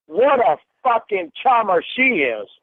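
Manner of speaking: loud, cutting sarcasm; furious sarcastic. Real, not acted. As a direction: spit out the mock praise. Sarcastic angry outburst.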